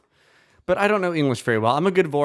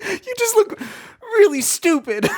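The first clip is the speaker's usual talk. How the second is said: high mocking voice. Sylphrena voice in fanfic reading.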